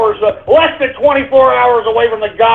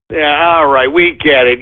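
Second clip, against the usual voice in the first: usual speaking voice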